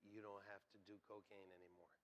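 very soft, low and gentle; quiet, low divine prompting; still small voice. Retold or imitated. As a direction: drop right down to a hushed inner voice. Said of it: "a still small voice said to me".

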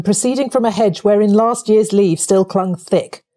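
ordinary delivery

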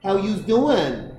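hammed-up Boston girl accent; thick Boston accent. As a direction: drawl it in a caricatured Boston accent. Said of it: "when it's that thick accent".

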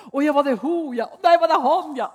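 mocking a chatty phone call she refuses to answer; mock phone chatter. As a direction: ham up a nosy caller. Mocking phone gossip she ignores.

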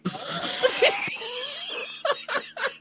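high helpless giggle; can't hold it in, laugh high; corpsing laugh. Real, not acted. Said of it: "commonly known as corpsing".

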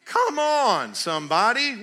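preacher's call. Real, not acted. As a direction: call the crowd to respond. Preacher calling on congregation.

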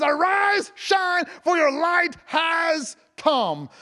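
high, loud and slow, word by word; exultant proclamation. Real, not acted. Preacher declaring Isaiah sixty, exultant slow shout. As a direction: proclaim it ringing out, stretching "has". Preacher re-reads verse, stressing has.

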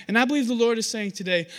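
ordinary delivery

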